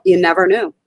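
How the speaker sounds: usual speaking voice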